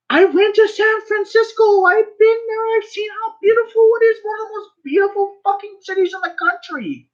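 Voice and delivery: very high, insistent rant; impassioned disbelief. Real, not acted. Insisting the city was beautiful, high-pitched and impassioned. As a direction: pitch pushed way up and held there. Shocked rant praising San Francisco.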